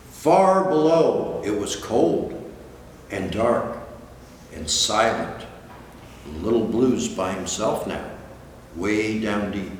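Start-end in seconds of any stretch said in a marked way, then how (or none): none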